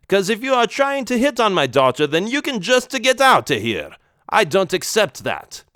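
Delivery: Italian don checking up on daughter; Italian accent, suspicious. Acted. Vincenzo confronts boy about daughter, Italian accent. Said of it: "thick Italian accent".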